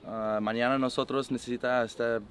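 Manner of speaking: halting Spanish, searching for words; speaking Spanish on request. Real, not acted. Quarterback sums up practice in Spanish for reporters, halting. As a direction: answer in careful, slightly unsure Spanish. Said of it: "Can you sum up today's practice in Spanish".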